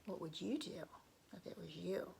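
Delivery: ordinary delivery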